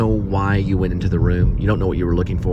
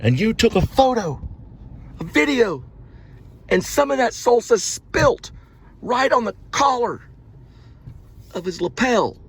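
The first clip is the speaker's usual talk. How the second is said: outraged complainer; 10 semitones higher than usual. Acted. Accusing someone of filming a man eating salsa, mock outrage. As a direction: build to a high, scandalised accusation.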